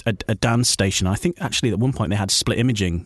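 ordinary talk, nothing marked

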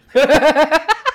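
high, loud real laugh rising; loud laughter. Real, not acted. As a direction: burst out laughing at the memory. 讲到一刀剪光大笑. (放声大笑)

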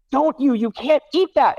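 high whiny kid yelling, imitated; mock a little girl yelling at her sister; imitating angry child. Dad voicing daughter yelling over the cake, high mock yell. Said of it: "She is yelling at".

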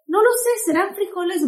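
high, casual sister's reply; lightly voiced careless sister; sister unsure. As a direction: shrug it off in a high voice. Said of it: "Me dice".

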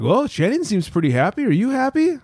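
high, rising mock question; mocking boss voice. Boss asks about his happiness, high mock voice. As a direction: mimic him prying. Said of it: "He's like".

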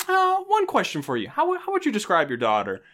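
a hint of a probing detective springing a question; Columbo detective. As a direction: mom doing a Columbo-style question. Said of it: "almost like a Columbo moment".

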